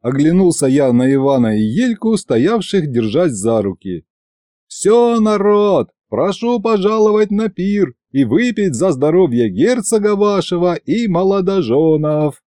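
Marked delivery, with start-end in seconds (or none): 4.7-12.4 high, projected festive proclamation; ringing master-of-ceremonies call; public announcement. A narrator's reading. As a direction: invite the whole square to the feast. Officiant announces feast to crowd.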